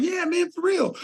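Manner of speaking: very high, hyped-up buddy voice; imitating Kelly. Kelly promising to stay in touch, very high excited imitation. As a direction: shoot way up like an overjoyed friend. Voicing Kelly's enthusiastic reply.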